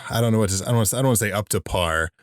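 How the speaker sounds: ordinary talk, nothing marked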